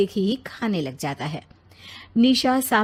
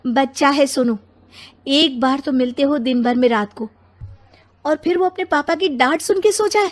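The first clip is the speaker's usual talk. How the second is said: louder, high reproach; upset wife; 5 semitones higher, 6 dB louder than usual. Acted. Wife loudly reproaching husband over shouting at son. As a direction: scold him for the child.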